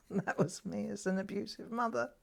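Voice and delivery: ordinary talk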